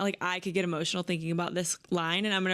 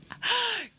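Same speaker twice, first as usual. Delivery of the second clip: high sharp gasp of delight; thrilled little gasp; excited gasp. Real, not acted. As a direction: gasp like you just got great news. Excited by the book description.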